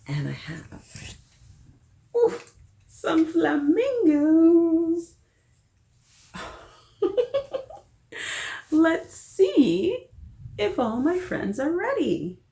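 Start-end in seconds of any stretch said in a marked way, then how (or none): 2.2-12.4 high, loud squeaky flamingo puppet voice. Pretend. Storyteller voicing a flamingo puppet for the kids, high and loud. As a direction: pop up as a silly flamingo puppet, much higher and louder.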